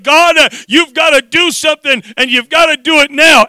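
high, insistent demand to God; urgent prayer. Retold or imitated. Father re-enacting overwhelmed prayer to God, urgent. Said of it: "And I prayed".

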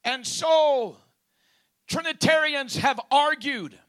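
very loud, high-pitched preaching in spaced phrases; full-force preaching. Real, not acted. Preacher sets out what Trinitarians have argued, full-force preaching. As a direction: belt out each phrase, pausing between.

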